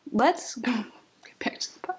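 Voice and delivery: slow unvoiced whisper. Real, not acted. Whispering an aside to viewers late at night. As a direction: hush it like it's the middle of the night.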